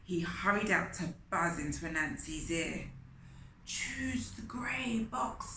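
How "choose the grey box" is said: slow unvoiced secret whisper. Fly whispering the answer to Anansi. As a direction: breathe it into his ear.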